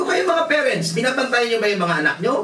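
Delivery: high, fast rebuke; confronting the parents. Retold or imitated. Pastor rebuking parents who don't watch their kids, high and fast. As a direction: call the parents out sharply.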